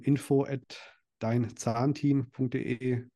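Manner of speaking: slow, spaced-out email address; dictating. Real, not acted. Guest gives listeners the application email address, dictating slowly.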